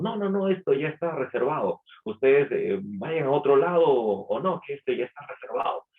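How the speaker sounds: quick dismissive no, no, no; annoyed mock refusal. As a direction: wave them off like they're pests.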